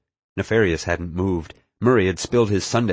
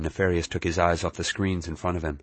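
ordinary delivery, nothing marked

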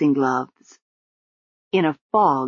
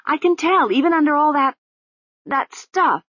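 higher, insistent accusation; accusing young woman; 9 semitones higher than usual. Acted. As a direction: press the accusation hard. Susan accuses.

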